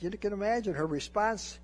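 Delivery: ordinary delivery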